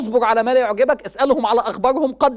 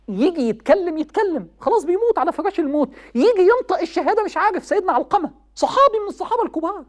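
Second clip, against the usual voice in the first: raised, pitched-up sermon voice; impassioned preaching; 8 semitones higher than usual. Acted. Preacher urging young people, impassioned. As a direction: preach it high and urgent to the crowd. Preacher recounts dying companion story.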